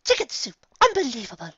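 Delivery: high, loud dramatic outrage; Rarity aghast. Acted. Rarity exclaiming about the soup, high and loud. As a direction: ham up the disgust. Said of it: "muttered Rarity".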